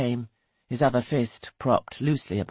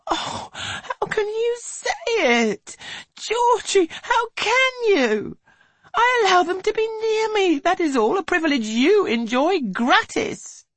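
weeping; 5 dB louder than usual. A narrator's reading. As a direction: plead through tears. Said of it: "She begins to weep again".

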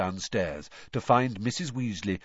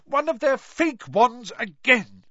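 high, slow, loud exclamation; furious high-pitched cry; angry shout; 12 semitones higher, 5 dB louder, slower than usual. A narrator's reading. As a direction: shout it in exasperation. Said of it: "she shouted".

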